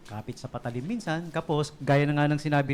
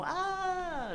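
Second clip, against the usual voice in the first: retold scream, pitched way up; mock scream; 5 dB quieter than usual. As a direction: squeal in mock terror as the lion comes close. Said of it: "sigaw ako".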